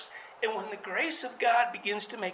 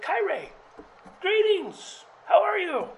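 hammed-up bright angel voice, much higher and louder; angels' greeting; 11 semitones higher, 6 dB louder than usual. Pastor voicing the angels greeting the shepherds, high, loud and playful. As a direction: sing out a cheery heavenly hello. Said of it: "they said".

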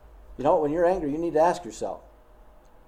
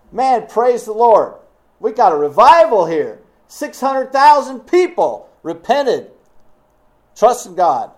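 mock joyful; 7 semitones higher, 9 dB louder than usual. Imagined joyful Jonah quote.

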